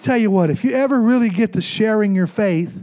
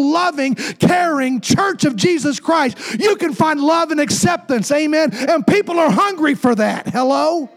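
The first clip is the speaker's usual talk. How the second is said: higher-pitched, rising preacher's cadence, building; preaching at full force; 7 semitones higher than usual. Acted. Pastor urging his congregation about loving church, fervent preaching. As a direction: lift the room with a fervent sermon call.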